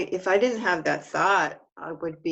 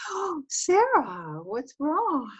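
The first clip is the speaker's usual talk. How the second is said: put-on much higher, slow little-child voice; imitating a little child; 10 semitones higher, slower than usual. Little child comforting crying Sarah, high and slow. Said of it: "they go".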